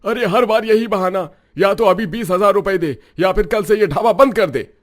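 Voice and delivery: high, loud, menacing demand; bullying shout. Acted. Landlord Ramesh threatens Ramu over unpaid rent, shouting high and loud. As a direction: bark it at him like a thug landlord.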